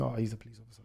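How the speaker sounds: quiet aside to yourself; inner thought. Retold or imitated. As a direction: think it to yourself, under your voice. Voicing his private suspicion.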